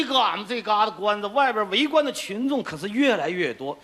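Director Wang's northeastern Chinese twang; northeastern accent. Acted. Director Wang talks to the trapped actor, northeastern accent. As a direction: speak like a boss from the northeast.

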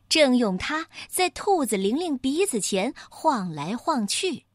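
plain reading voice

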